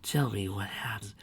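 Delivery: hushed soothing whisper; soft motherly whisper; tender whisper. Acted. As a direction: coax the story out gently.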